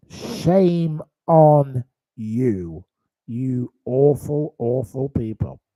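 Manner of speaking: each word spaced out slowly and firmly; slow scolding. Real, not acted. Host rebukes those who mocked a widow, slow scolding. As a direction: draw out the reproach word by word.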